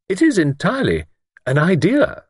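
high-pitched, mildly anxious lady; a touch of worry in a high voice. A narrator's reading. Miss Wainfleet admitting she lacks evidence, anxious. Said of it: "Miss Wainfleet explained anxiously".

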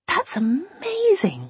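high, slow dreamy amazement; sighing wonder. A narrator's reading. Imogen overcome by the flowers, high sighing wonder. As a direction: sigh the words out, stretched with delight. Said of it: "Imogen sighed".

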